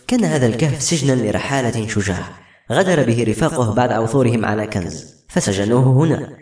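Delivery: ordinary delivery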